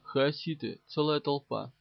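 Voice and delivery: ordinary delivery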